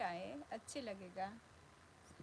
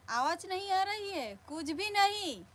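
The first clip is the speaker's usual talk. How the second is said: high, raised, insistent voice; pitched up and emphatic; loud protest; 8 semitones higher, 9 dB louder than usual. Real, not acted. Protesting that nothing is audible.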